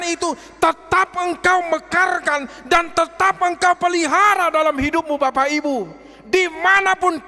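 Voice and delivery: high, loud impassioned preaching; preaching at full force. Acted. Preacher urging the congregation to repay kindness, impassioned high shout. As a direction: cry it out to the congregation.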